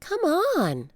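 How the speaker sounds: put-on high eager little girl. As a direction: call out like an excited kid. Annie voiced by narrator.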